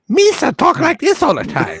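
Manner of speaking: high, loud cartoon alien voice; Jar Jar-style voice. Helmet wearer showing off the silly voice, high goofy alien. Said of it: "if you say it like that".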